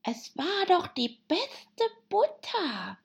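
high, slow, plaintive hare; meek March Hare. Acted. March Hare with nothing better to say, high and meek. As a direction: repeat it helplessly, drawn out and high. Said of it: "seine letzte Bemerkung zu wiederholen".

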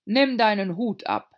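pompous king orders. A narrator's reading. King voiced.

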